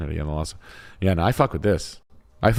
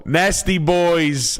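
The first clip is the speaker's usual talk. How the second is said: much higher, slow hammed-up New Jersey guy drawing out "Nasty Boys"; filthy Jersey boy voice; 9 semitones higher, much slower than usual. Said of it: "in your best filthy P O S Jersey boy voice".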